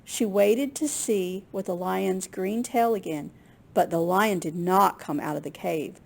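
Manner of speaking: ordinary delivery, nothing marked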